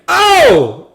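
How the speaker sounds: high shocked "Oh!"; shocked gasp. Retold or imitated. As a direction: gasp it out, horrified. Doctors at birth, shocked.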